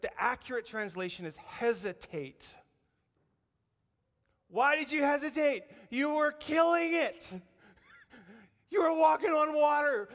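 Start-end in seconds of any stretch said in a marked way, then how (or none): 4.5-10.1 from high and loud to a shout; preacher builds to a shout over Peter's courage; rising excitement. Real, not acted. Preacher cheering Peter on, building to a shout. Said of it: "preacher builds to a shout".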